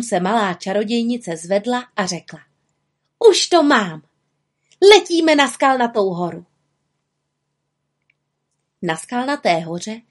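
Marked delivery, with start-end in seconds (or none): 3.3-6.5 raised, excited little witch; higher-pitched burst of eagerness; triumphant announcement. Acted. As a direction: announce the discovery with delight. Witch announces her discovery.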